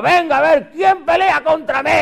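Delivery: put-on taunting bellow; challenger's taunt. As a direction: call out a dare to a whole army. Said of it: "encuentra un tipo que salía".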